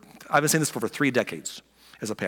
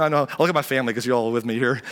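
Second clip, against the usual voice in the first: ordinary talk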